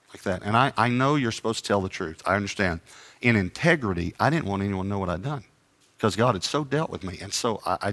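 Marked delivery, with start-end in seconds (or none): none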